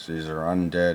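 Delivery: ordinary talk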